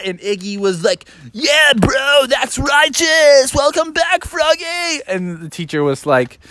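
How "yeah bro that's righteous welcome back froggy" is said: high, whooping excited voice; cheering buddy. Acted. Iggy welcoming Froggy back, high excited cheer. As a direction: holler a stoked welcome.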